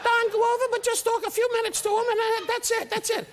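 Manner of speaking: very high, strained manager voice; Joe Scandori. Retold or imitated. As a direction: imitate a nervous manager giving instructions. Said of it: "He always talked like this".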